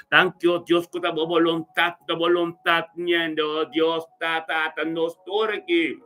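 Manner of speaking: ordinary talk, nothing marked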